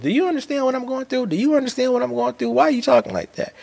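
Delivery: high, fast, whiny mock plea; exaggerated cartoon intonation. Mocking his own emotional plea.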